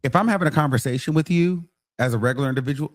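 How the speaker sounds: normal talking voice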